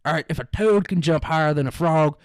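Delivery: put-on loud folksy country-boy accent. Convincing classmate he's from the country, loud country accent. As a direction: proclaim it like a backwoods old-timer. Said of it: "make this guy think I'm from the country".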